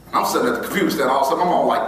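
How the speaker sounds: usual speaking voice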